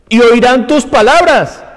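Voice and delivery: forceful scripture preaching. Real, not acted. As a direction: proclaim God's judgment at full force. Preaches the verse at full force.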